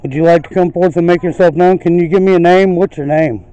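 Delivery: loud, high projected questions; calling out to spirits. Real, not acted. Ghost hunter asking a spirit its name, calling out loudly. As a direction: send each question out to someone unseen.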